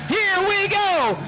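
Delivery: announcer shouting the last lap; excited shout. Acted. Final lap, crowd watching.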